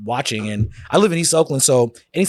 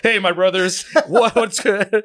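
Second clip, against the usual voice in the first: Sheamus; 4 semitones higher than usual. Retold or imitated.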